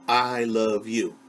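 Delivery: normal talking voice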